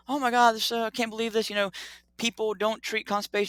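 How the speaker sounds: high, gushing fanboy excitement; starstruck gush. Retold or imitated. Doctor finally reaching the researcher he admires, high excited gush. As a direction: bubble over at meeting your hero.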